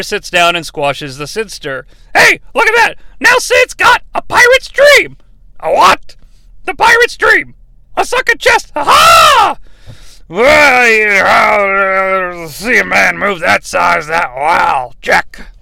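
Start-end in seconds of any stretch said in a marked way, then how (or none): 2.1-9.6 very high, gleeful cackling voice; excitable ringside commentator. Acted. Commentator joking about a pirate's dream, high gleeful squawk. 10.3-15.5 groaning, slurred drawl; drunk wrestler. Acted. Drunk wrestler admiring a big man's move, slurred drawl. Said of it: "He's under the influence".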